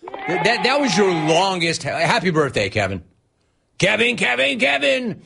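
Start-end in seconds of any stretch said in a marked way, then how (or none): 3.8-5.2 high sing-song name call. Acted. Host calling out the caller in a high sing-song. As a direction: chant the name up high like a bit.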